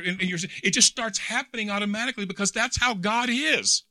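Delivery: high, loud, rising preacher's cry; preaching at full force. Acted. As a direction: preach it out with mounting fervor. Preacher exhorting the congregation.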